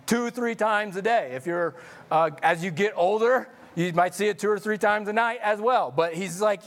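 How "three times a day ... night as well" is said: ordinary talk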